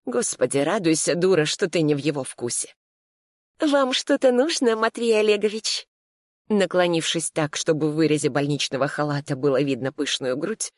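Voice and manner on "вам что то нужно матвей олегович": much higher, coy, sultry question; languid, flirtatious. Acted. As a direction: purr it to catch his eye. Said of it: "томно спросила девушка". (томно, кокетливо, высоко)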